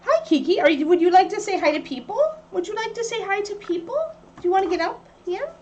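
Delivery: high, sing-song pet voice. Real, not acted. Talking to the dog Kiki.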